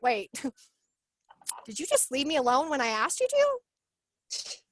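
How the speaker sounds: slow it down, incredulous, laugh breaking in; astonished disbelief. Retold or imitated. Reacting to a coach who backed off when asked, astonished.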